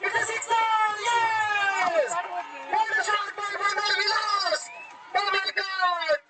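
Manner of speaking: high, shouting six call; excited cricket commentary. Real, not acted. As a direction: scream with joy at the six. Six hit, commentator erupts.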